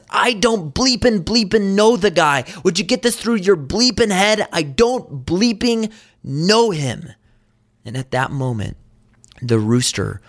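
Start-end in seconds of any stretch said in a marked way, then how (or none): 0.1-7.1 high-pitched, acted exasperated outburst; emphatic cursing denial. Peter denies Jesus a third time, acted emphatic cursing. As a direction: hammer each censored curse in a mock tirade. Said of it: "he does so emphatically".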